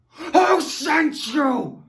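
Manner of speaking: slow, loud menacing questioning; mock interrogation. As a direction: grill him like a spy. Acted interrogation, example sentence.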